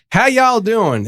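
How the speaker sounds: high-pitched Southern drawl greeting; Southern accent. Shown as a demonstration. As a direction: drag it out in a Southern twang. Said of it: "I usually gotta start out with something like".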